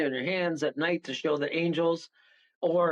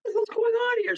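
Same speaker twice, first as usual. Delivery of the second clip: startled outburst; much slower than usual. Retold or imitated. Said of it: "And I go".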